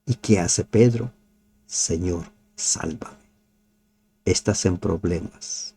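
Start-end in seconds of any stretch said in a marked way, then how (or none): 1.7-3.2 hushed plea. Shown as a demonstration. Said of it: "Grita como Pedro".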